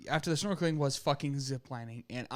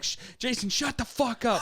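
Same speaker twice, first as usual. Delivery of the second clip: retold freak-out; 7 semitones higher than usual. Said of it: "You were freaking out".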